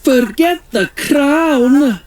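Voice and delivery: mild mock yell. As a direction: shout to snap them out of it. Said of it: "yelled Nessie".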